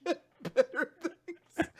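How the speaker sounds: loud plea breaking into laughter; laughing lament. Real, not acted. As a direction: complain loudly while cracking up.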